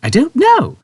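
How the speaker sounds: high, loud bafflement; Trillian, baffled. A narrator's reading. Trillian answering Zaphod, baffled. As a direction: admit it helplessly.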